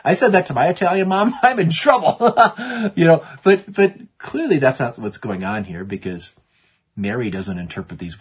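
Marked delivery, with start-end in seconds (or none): 0.0-4.6 high, loud, breaking into laughter; laughing. Real, not acted. Preacher jokes about his Italian mom, laughing. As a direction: laugh at your own joke as you say it.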